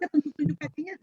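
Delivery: ordinary talk, nothing marked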